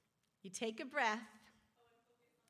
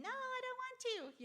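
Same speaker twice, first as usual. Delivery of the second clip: put-on whiny child; faster than usual. Child refusing, voiced by teacher.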